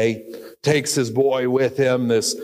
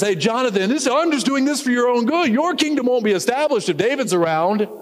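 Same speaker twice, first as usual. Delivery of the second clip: coaxing father; 11 semitones higher than usual. Acted. Saul coaxing Jonathan.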